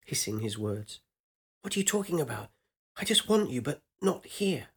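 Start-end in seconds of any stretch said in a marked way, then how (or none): 1.6-2.5 higher voice, stress on "are"; young woman voice. Acted. First asking, stress on are. 3.0-4.7 higher, pleading woman's voice; young woman voice. Acted. Woman's high pleading reply.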